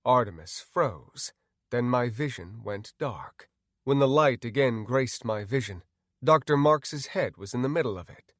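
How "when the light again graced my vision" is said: usual speaking voice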